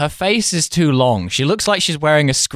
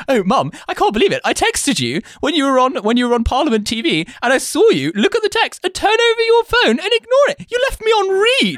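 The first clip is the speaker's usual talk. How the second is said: much higher, fast, whiny and laughing; mock posh Flora Gill; 14 semitones higher than usual. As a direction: whine to mum like a spoiled posh daughter. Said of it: "So the bit was just like".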